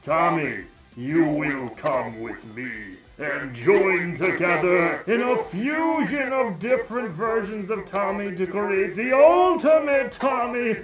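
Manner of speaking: grandiose evil overlord through a voice changer; Lord Draken. Pretend. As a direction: start slow and commanding, end loud and overblown. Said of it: "that Lord Draken would say?".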